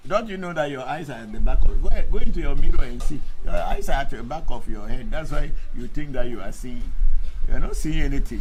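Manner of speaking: loud, fast rebuke; angry lecture to the commenter; heated scolding. Real, not acted. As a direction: scold him for not seeing. Mocking a commenter's blindness.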